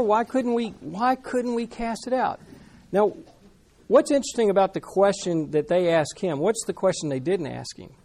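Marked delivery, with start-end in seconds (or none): 0.0-2.4 bewildered disciples. Retold or imitated. Disciples puzzled at failed exorcism.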